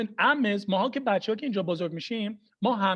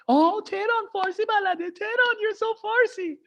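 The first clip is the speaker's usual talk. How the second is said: very high, gushing flattery; mock gushing praise; 12 semitones higher than usual. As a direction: coo high in exaggerated admiration. Mocking the aunties' flattering trick.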